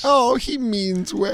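high, slow doting mom; sweet mom voice. Retold or imitated. Mocking Jacob's mom.

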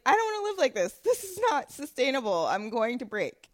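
high, worked-up "I can't go on" to oneself; exasperated outburst. Retold or imitated. Host recalls telling herself she can't go on, exasperated. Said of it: "And I was like".

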